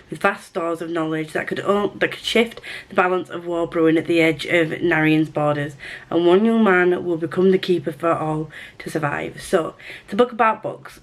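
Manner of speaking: ordinary talk, nothing marked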